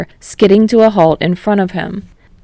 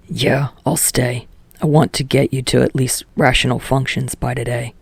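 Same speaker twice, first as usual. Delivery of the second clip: put-on a lower, sullen teen voice; Katsuki's voice; 5 semitones lower, 4 dB quieter than usual. Katsuki brushing off Kirishima, in a lower sullen voice. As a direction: drop lower and grumble it out as Katsuki.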